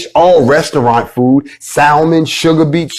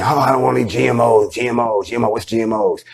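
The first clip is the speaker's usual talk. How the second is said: softer, toneless mock complainer; offhand mimicry, trailing quieter; mocking imitation; 5 dB quieter than usual. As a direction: imitate someone muttering a clueless complaint. Mimicking people dismissing GMOs.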